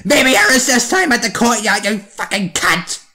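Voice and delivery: high, loud mock teacher tirade; imitated angry yell. Teacher yells at a pupil in class, mock-furious yell. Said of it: "she yelled at me and said".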